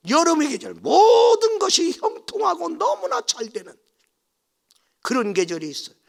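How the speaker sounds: loud, high sermon build; rising preaching. Acted. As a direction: lift the church with it. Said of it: "sermon builds, loud and high". (목청 높여 외치는 설교)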